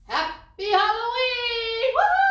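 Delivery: loud, high holiday shout; exuberant cheer. Acted. Reader celebrating Halloween at story's end, exuberant howl.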